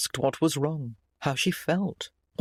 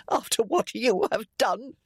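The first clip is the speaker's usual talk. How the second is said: high, strained croak of reproach; 7 semitones higher than usual. A narrator's reading. Sick mother reproaching her son, croaking. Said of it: "their mother croaked".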